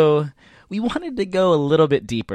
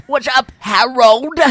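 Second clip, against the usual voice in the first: higher, louder, slow put-on buddy voice; Jerry voice; 8 semitones higher, 6 dB louder, slower than usual. Host as old friend Jerry greeting Harold in a high, slow, put-on voice. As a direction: greet him like a goofy old pal. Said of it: "you did a specific voice".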